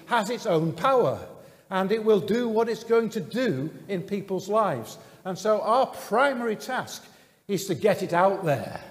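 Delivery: full-force sermon delivery; fervent preaching. Acted. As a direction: preach it out to the whole congregation. Preaching the kingdom message.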